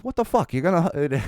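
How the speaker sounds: a playful attempt at an English accent. An English friend ribbing Sean for ignoring him, mock English accent. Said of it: "I can't do a good English accent".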